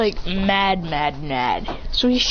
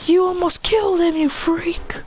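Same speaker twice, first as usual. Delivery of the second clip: high, jeering yell; angry crowd jeer; 7 semitones higher, slower than usual. Acted. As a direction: hurl the insult.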